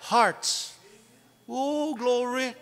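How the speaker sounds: ordinary talk, nothing marked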